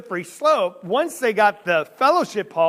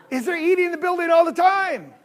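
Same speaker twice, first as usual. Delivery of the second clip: higher and louder challenge; rhetorical shout; 8 semitones higher, 5 dB louder, faster than usual. Real, not acted. Preacher challenging the congregation, loud and high. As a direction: call it out to the whole room.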